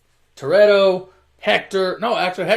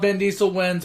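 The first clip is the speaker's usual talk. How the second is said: usual speaking voice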